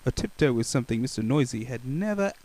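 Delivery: usual speaking voice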